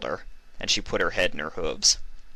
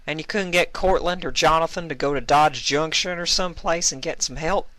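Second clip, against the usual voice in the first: put-on high young mare voice; 10 semitones higher than usual. Applejack voiced in story.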